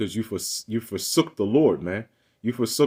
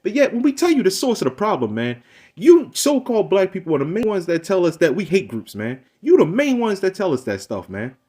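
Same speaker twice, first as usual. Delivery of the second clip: heated accusation; 7 semitones higher, 4 dB louder than usual. Real, not acted.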